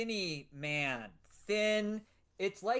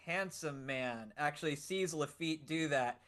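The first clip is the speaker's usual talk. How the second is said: ordinary talk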